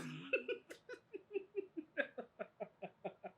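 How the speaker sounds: long high cackle; helpless laughing; real laugh. Real, not acted. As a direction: burst out laughing at yourself. Laughing at own story.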